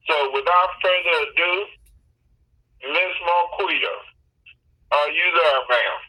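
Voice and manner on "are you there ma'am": ordinary delivery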